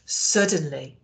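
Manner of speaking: ordinary delivery, nothing marked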